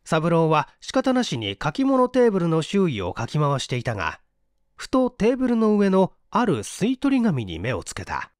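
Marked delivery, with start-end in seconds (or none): none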